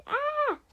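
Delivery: very high, loud scream; high acted yell of alarm; shouted "ah". A narrator's reading. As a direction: scream "ah" as in a cartoon fright.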